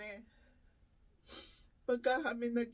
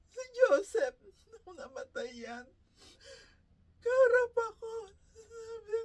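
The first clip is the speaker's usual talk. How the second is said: real crying; 9 semitones higher, 3 dB louder than usual. Real, not acted. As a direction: voice breaking into high sobbing words. Grieving mother recalls dying son. (umiiyak habang ikinukuwento ang anak na namamatay)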